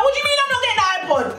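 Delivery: very high-pitched indignant outburst; sudden outrage. Retold or imitated. Girl refused the iPod, switching to outrage. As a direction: switch into shrieky, retold indignation. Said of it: "Guys, I switched".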